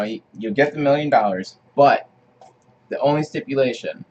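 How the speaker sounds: ordinary talk, nothing marked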